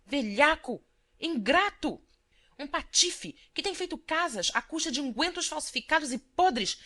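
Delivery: loud, high furious insults; a wife shouting insults in her anger; angry outburst. A narrator's reading. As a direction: rail against him in rage. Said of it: "Nas explosões da cólera".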